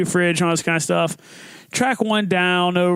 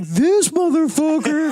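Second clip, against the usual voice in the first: high, drawn-out delighted jeer; imitating a buddy gleefully ratting you out; overjoyed gloating; 8 semitones higher, slower than usual. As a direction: relish every syllable. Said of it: "how loud and how overjoyed he was".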